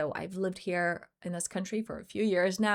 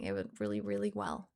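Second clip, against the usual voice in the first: tearing up; 11 semitones lower, 5 dB quieter than usual. Real, not acted. Mother moved to tears retelling her answer, low and choked. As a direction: voice drops low and soft, choked with emotion. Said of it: "I'm tearing up right now".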